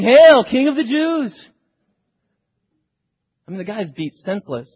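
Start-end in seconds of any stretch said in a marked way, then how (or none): 0.0-1.5 loud, high, slow mock salute; mocking hail. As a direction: jeer it like kneeling soldiers taunting a king. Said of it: "They're mocking him".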